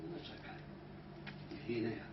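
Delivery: soft, calm reassurance; calm and quiet. Shown as a demonstration. Hypnotist reassuring a late client, calm and soft. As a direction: settle the late client gently. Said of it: "calmly and quietly".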